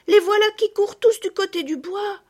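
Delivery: high, loud alarmed cry; exclaiming. A narrator's reading. Girl alerting the others, high loud cry. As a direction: shout the alarm. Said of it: "s'écria Marguerite".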